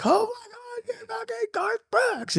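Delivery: squealing high-pitched fan gush; starstruck fan. Retold or imitated. Stranger spotting [name] in public, high squealing fan gush. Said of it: "one person comes up like".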